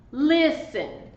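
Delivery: "Listen" with the stress on LIS; first syllable of "Listen" stressed, second one light. Shown as a demonstration. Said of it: "The stress is on the first syllable".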